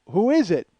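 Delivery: loud, high called-out question; shouting back. Retold or imitated. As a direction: yell the question to another room. Shouting back across house.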